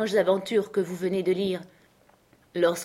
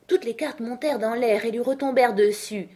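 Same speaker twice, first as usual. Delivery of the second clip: ordinary delivery, nothing marked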